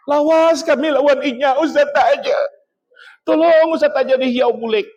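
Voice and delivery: high, wailing imitation of a weeping man; mock crying. Husband begs the ustaz to call her back, mock crying. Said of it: "Nangis jua nang lalaki itu jua".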